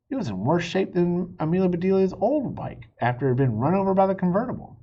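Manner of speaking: usual speaking voice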